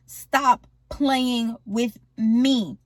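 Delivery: drawn-out, word-by-word threat; slow menacing warning. Retold or imitated. Dad warning the artist holding out on him, slow and menacing. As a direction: stretch each word as a warning.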